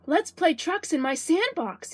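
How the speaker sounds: put-on slow high child voice. A narrator's reading. Andrew suggests trucks.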